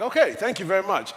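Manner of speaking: high-pitched mock-polite thanks. Retold polite reply to receptionist.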